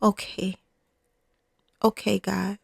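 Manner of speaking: hushed reluctant acceptance; quiet okay. Pretend. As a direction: murmur it as a tired surrender. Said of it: "Sometimes a yes is just".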